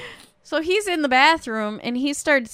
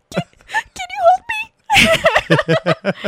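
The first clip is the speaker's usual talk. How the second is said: very high, slow mock sobbing; ugly crying; 6 dB louder, much slower than usual. Crying husband asks wife to hold him, mock sobbing. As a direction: stammer it out in a hammed-up wail. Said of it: "like, ugly crying".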